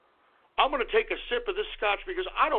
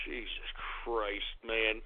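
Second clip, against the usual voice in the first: exasperated sigh; 14 semitones lower, 11 dB quieter, slower than usual. Acted.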